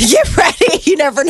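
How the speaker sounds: playful call. Pretend. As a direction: shout it like a jokey alert. Echoing the warning playfully.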